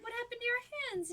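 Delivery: high, rushed worried question; alarmed concern. Acted. Wife going over to check injured fingers, alarmed.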